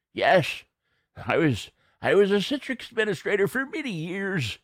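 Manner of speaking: put-on reminiscing old-timer voice; old man voice. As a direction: talk like a grizzled old-timer looking back. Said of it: "The old man voice".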